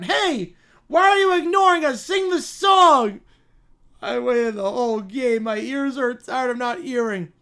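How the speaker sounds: put-on bratty child. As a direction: whine like a spoiled child. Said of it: "the Brat Pack".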